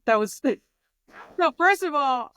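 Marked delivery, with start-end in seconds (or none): 1.4-2.3 loud, high radio DJ hype. Real, not acted. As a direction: announce it like a club DJ. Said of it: "I sounded like a D J".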